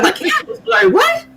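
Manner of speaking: squeaky, incredulous retold reaction; high disbelieving squeal. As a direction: pitch way up in mock disbelief. Retelling her own confusion.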